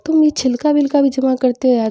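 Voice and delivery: high, loud surprised question to mom. Retold or imitated. Daughter asking mom why she's saving peels, surprised. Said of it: "mummy को".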